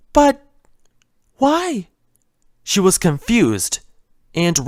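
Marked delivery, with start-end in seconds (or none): 0.1-1.9 a timid pony fretting over a broken vase; Fluttershy's voice. Acted. Fluttershy frets to herself over the vase, higher and gentle.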